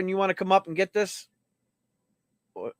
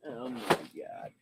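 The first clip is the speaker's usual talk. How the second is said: wheezing laugh; 5 semitones lower, 12 dB quieter, much slower than usual. Real, not acted. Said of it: "I'm losing it".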